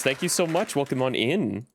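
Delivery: ordinary delivery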